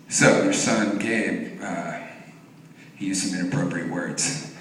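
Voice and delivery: low, soft, hesitant official voice; awkward school caller. Retold or imitated. School calling parent about son, soft and hesitant. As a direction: imitate a school breaking news delicately.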